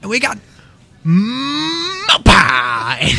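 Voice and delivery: over-the-top Cajun huckster; ecstatic pastry hawker. Retold or imitated. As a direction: stretch mo pie out with glee. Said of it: "It'd be like".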